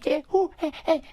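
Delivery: high-pitched nonsense babble; Adam Sandler gibberish. Acted. Guest shows Sandler's second phase, high gibberish. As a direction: babble wordless syllables way up high. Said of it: "he does the thing where it's like".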